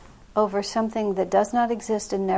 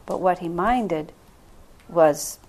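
ordinary delivery

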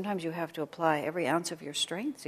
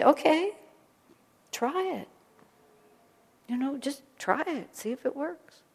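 lightly challenging, higher voice; a playful dare to just try it; mild dare; 8 semitones higher than usual. Retold or imitated. Teacher daring a doubter to test it, playfully. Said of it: "you just say".

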